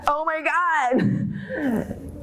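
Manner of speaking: slow, high, laughing oh my God; laughing groan. Real, not acted. Laughing with the audience about unreturned calls. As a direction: laugh it out in disbelief.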